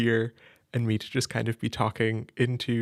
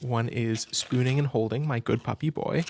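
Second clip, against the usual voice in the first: ordinary delivery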